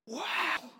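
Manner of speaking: put-on high, amazed little-kid wow; child's wow. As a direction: gasp it out like a child in the back seat. Daughter in back seat.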